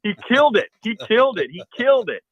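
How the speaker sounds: high, loud elated yelling; screaming with joy. Retold or imitated. Hunter screaming to friends over the kill, ecstatic high shout. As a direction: shout it out like your buddy just dropped a buck. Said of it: "I am screaming as loud as I can".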